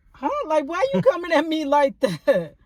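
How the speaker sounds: high, incredulous huh; shocked disbelief at being snapped at; mock offended surprise; baffled outrage. Baffled reply to the manager.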